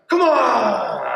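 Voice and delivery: high, drawn-out mock battle cry; fired up. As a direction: imitate a hyped-up rallying yell. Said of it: "We're gonna fire it up".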